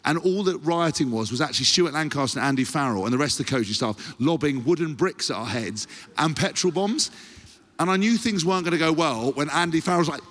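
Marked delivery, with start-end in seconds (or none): none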